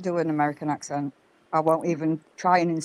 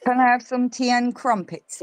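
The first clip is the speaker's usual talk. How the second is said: mock posh British; 7 semitones higher than usual. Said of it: "best accent ever with that".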